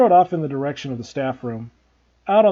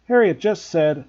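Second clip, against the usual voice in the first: usual speaking voice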